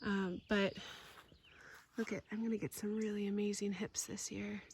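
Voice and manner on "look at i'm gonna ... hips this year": whisper. Real, not acted. Looking at rose hips.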